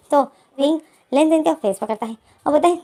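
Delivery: usual speaking voice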